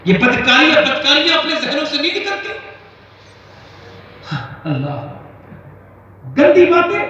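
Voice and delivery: loud, high bursts of sermon; preacher crying out against sin; fiery preaching. Acted. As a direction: hammer each sin at the crowd. Preacher exhorting at full force.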